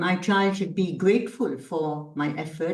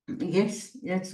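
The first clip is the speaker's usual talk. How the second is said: ordinary talk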